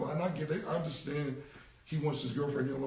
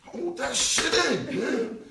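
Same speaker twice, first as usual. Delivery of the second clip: mock exasperated yell; 7 semitones higher, 6 dB louder, much slower than usual. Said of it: "I'll be like".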